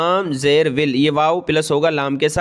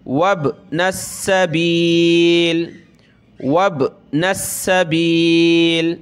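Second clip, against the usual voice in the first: slow chanted recitation; teacher chanting the verse for students; Quran recitation; much slower than usual. Shown as a demonstration. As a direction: recite slowly with a melodic lilt.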